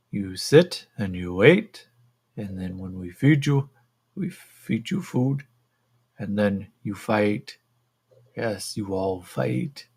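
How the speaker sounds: slow alien creature voice; heavy, deliberate creature speech; modified American. Acted. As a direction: spell it out slowly as an alien in accented English. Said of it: "in kind of that modified American".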